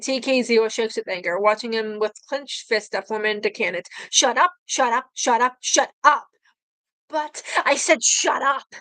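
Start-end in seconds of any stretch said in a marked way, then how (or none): 4.1-6.3 louder, furious repeated yelling; repeated angry yell. Acted. Character repeats furious command. 7.1-8.7 slow, stretched furious threat; slow menacing anger. Acted. Furious repeat of command.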